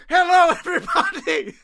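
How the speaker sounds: high laughing call; joyful greeting. Retold or imitated. Old man runs out greeting everyone, high and laughing. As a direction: greet the whole town gleefully. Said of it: "he runs out".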